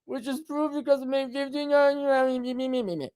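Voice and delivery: high, garbled, whiny mimicry; mock viewer's reply. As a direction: whine it back, dissolving into babble.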